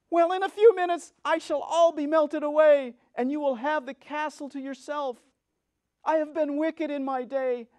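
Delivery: high, loud witch voice, slowing near the end; the dying witch's last lament; melting witch, despairing. Acted.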